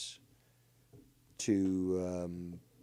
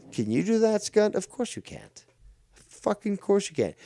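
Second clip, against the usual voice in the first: taunt; 11 semitones higher than usual. Real, not acted. Taunting Scott in raised voice.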